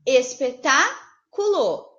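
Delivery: espetáculo said slowly with a break in a new place; syllable-split espetáculo. Shown as a demonstration. Teacher showing a second split of espetáculo, said slowly. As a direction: stretch out espetáculo, pausing where it splits. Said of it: "Ou ainda".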